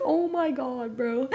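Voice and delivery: laughing through the words; laughing disbelief. Retold or imitated. Narrator laughing at her mom's antics, laughing disbelief. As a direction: crack up while saying it.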